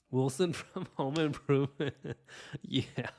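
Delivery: loud, high, laughing recognition. Real, not acted. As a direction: break up laughing mid-phrase. Amused by a viewer's comment.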